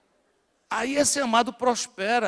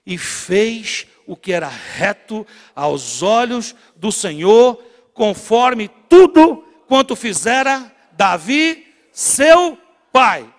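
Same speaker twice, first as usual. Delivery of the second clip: scripture read aloud slowly and loudly; slow loud group reading; 12 dB louder, much slower than usual. Shown as a demonstration. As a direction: lead the congregation reading, each word drawn out. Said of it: "Leia junto comigo".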